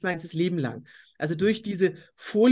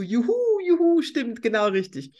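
high, delighted juhu; mock cheer; 4 semitones higher than usual. She cheers at the psychologist's diagnosis, delighted mock whoop. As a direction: cheer like you won a prize. Cheering at being told her value.

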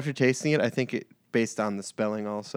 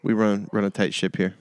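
usual speaking voice

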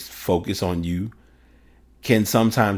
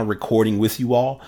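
ordinary talk

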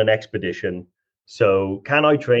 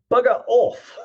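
mock British; 10 semitones higher, slower than usual.

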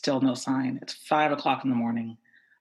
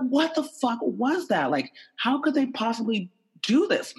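high-pitched, incredulous outrage; indignant disbelief, high and worked up; outraged; 10 semitones higher than usual. Retold or imitated. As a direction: demand an explanation, pitch way up. Retold outrage after the police stop.

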